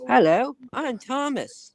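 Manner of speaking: high, slow cheerful engine voice; slow high cartoon engine greeting; Thomas impression. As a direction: pitch it up and draw it out. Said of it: "this is my Thomas impression".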